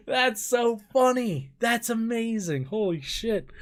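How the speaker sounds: high voice left over from laughing; giddy, tickled; real amused disbelief. Real, not acted. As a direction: marvel while recovering from a laughing fit. Recovering from laughing fit.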